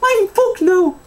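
squeaky put-on Irish lady; high mock Irish woman. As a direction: squeal it back in her voice. Mocking the Sarah Millican comparison.

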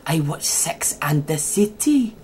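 slow, soft Welsh character voice; softly drawn-out Welsh impression; Uncle Bryn. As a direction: imitate a Welsh sitcom uncle, quietly. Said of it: "do Uncle Bryn when he used to go".

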